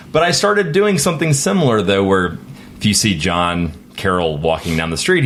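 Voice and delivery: ordinary delivery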